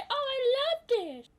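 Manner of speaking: high, drawn-out fake enthusiasm; mock gushing. Mocking someone who takes back hating a food, high slow gushing. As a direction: stretch it out high, parodying a convert.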